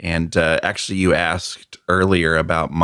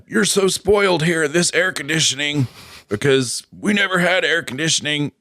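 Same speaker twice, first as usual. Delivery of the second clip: higher-pitched mock parental lecture; imitating complaining dad; 8 semitones higher than usual. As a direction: scold like Dad about spoiled kids. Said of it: "my dad always talking about how".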